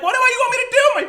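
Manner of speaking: fast, high, loud cry of panic; panicked yell. Retold or imitated. Stuck in traffic, yelling at a puking passenger, panicked. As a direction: throw up your hands and shout it, fast.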